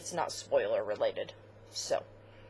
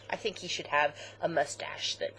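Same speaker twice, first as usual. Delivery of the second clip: normal talking voice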